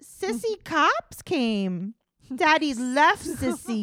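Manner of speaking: high, loud, drawn-out mock baby talk. Imitating her telling the child daddy left, baby talk. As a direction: coo bad news like a toddler lesson. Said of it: "like, to a baby".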